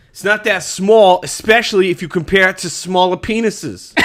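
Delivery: loud, high Boston accent; Bostonian accent. Shown as a demonstration. Player reading a card, loud Bostonian accent. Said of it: "I got Bostonian is mine".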